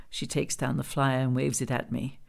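ordinary delivery, nothing marked

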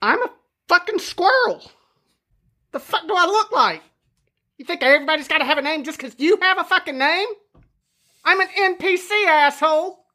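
high, fast, rude squirrel; angry squirrel voice. Acted. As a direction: snarl it like a cranky squirrel.

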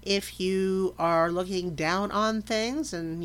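normal talking voice

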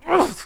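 high, loud frustrated "ugh"; exasperated yell. Retold or imitated. Driver after a near accident, yelling in frustration. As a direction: burst out with a strangled groan.

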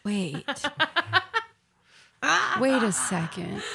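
softly, with a long pause as it sinks in; dawning realization. Retold or imitated. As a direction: let it dawn on you quietly.

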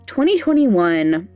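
ordinary talk

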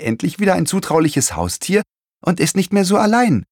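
put-on high, excited child's exclamation; lightly acted happy outcry; calling out. A narrator's reading. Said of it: "rief Sophie".

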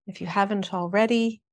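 calm, even meditation guidance; guided meditation. Acted. Shaman guiding an online group through breathing, calm meditation voice.

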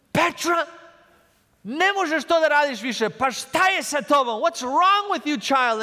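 angry scolding. Retold or imitated. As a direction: snap at her in frustration. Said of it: "And I got angry".

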